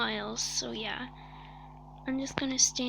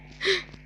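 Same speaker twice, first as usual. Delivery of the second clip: fake heart attack gasp; 6 semitones higher than usual. Sudden gasp after surviving.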